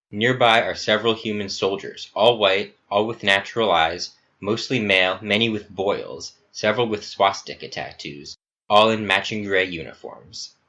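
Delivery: plain reading voice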